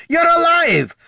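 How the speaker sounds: very high, loud exclamation; amazed shout; crying out. Acted. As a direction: cry it out in joyful shock. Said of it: "Gorm cried".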